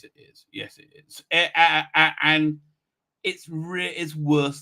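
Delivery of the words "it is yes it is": whispered aside. Real, not acted. Answering own question under breath.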